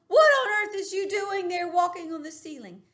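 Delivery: loud, high-pitched scolding yell; big sister yelling in outrage. Acted. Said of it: "she is starting to yell at me".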